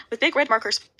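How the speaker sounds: ordinary delivery, nothing marked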